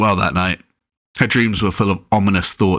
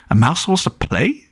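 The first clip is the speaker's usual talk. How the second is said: puzzled; 9 semitones higher than usual. Acted. Confused girl in dream.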